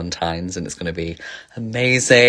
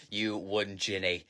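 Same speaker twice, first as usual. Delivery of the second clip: ordinary delivery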